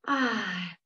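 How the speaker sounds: soft, drawn-out sigh; gentle acted sigh. A narrator's reading. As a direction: let out a long, quiet storybook sigh. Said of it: "suspiró".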